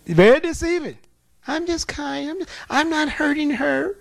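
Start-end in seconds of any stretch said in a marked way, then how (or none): 1.5-4.0 higher, sugary put-on voice; nice and demure demon. Demon insists to pastor it is harmless. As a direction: play innocent, sweetly. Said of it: "called himself being nice and demure".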